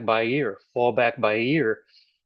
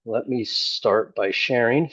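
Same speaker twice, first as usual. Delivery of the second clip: usual speaking voice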